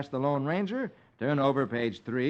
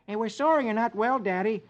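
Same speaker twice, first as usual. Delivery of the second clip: lightly voiced child; 9 semitones higher than usual. Child Alexander speaks.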